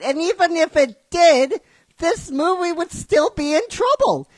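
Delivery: normal talking voice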